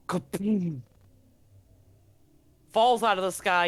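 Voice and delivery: usual speaking voice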